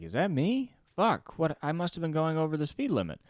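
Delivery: high, startled, half-panicked inner voice; suddenly realizing the cop is after you; panicked surprise. Retold or imitated. Panics seeing police lights.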